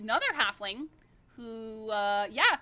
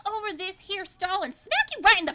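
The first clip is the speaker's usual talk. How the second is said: loud, high, furious threat; angry Mina Lee; 7 semitones higher, 5 dB louder than usual. Acted. Furious Mina Lee threatening the halfling twin, loud. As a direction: snap out a threat to swing over the stall. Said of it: "She's like".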